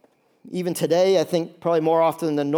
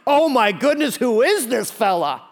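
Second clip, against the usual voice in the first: high, amazed gasp of wonder; amazed crowd; 4 semitones higher, 3 dB louder, slower than usual. Retold or imitated. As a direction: voice the stunned crowd.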